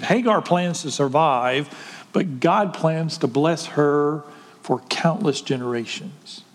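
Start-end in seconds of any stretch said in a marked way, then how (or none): none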